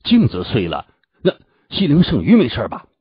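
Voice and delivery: mildly tense, urgent asking; nervous question. Acted. Sect leader asks after the sacred fish, mildly nervous. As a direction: ask with a touch of worry. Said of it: "乌云良紧张的问道".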